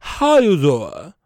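slow, loud, slurred 'how you zaw'; Wendy Williams. Retold or imitated. Host showing how talk-show host says it, slow slurred catchphrase. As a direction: stretch it loud, lips dropping on 'doing'. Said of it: "She says".

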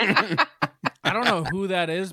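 laughing while talking; words pushed out through a laugh; laughing loudly, slowly. Real, not acted.

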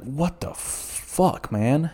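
high, drawn-out disbelief; retold bafflement. Masked shopper reacting to a heckler, slow high disbelief. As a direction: stretch it out slowly, incredulous.

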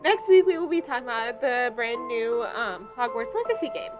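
loud, upbeat host sign-off; podcast outro. Acted. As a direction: sell the outro like a radio host. Podcast outro read over music.

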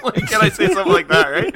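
laughing hard, high and loud; cracking up mid-sentence. Real, not acted. Dad cracking up while retelling his joke on the kids, laughing.

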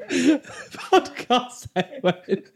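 very slow, laughing Yoda voice; Yoda impression. Host echoing the Yoda line while laughing, very slow. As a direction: stretch it out through laughter, Yoda style. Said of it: "That's him".

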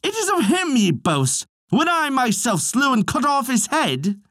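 high, proud boasting warrior; boastful warrior. A narrator's reading. A warrior boasting to the feasting hall, pitched high. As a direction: crow over the dead man's name, pitched high. Celtcar boasts over the hero.

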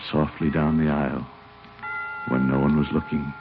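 gentle storybook reading; story narration. Acted. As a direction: read it like a bedtime story. Reads a story aloud.

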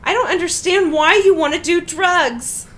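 put-on half-playful loud kid voice; mock loud child. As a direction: blurt it out loud like a kid in public. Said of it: "my son said very loudly".

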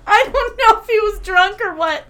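loud, high voice cracking with laughter; laughing almost to tears in disbelief; helpless laughing. Real, not acted. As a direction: squeal it through real laughter. Laughing over fake plant gift.

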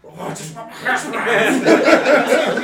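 slow, high, drawn-out whine through laughter; whiny Terrell impression. Friend mimics a pal pining for his hash browns, slow laughing whine. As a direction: stretch it out like a sulking kid. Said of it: "And Terrell just".

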